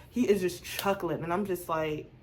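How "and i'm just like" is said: ordinary delivery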